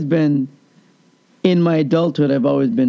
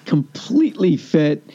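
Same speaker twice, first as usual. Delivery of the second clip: usual speaking voice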